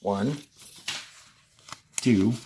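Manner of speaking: ordinary talk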